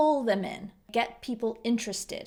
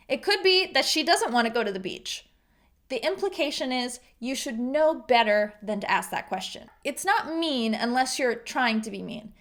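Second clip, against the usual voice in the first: ordinary talk, nothing marked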